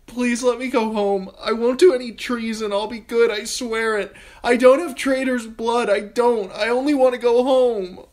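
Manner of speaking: put-on terrified girl pleading with the king; desperate pleading. A narrator's reading. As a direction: beg for your life, breaking down. Sansa pleads to Joffrey.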